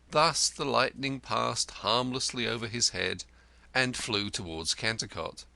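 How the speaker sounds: ordinary delivery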